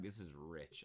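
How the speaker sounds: ordinary talk, nothing marked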